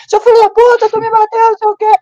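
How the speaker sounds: mock enraged yell. Voicing the enraged attacker.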